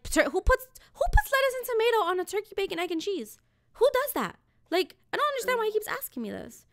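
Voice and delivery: high-pitched baffled complaint; incredulous. Retold or imitated. Customer baffled by the deli guy's questions, high and incredulous. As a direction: question it in a high, disbelieving rush. Ranting at his topping question.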